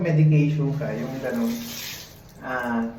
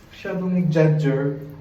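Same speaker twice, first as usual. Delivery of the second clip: ordinary delivery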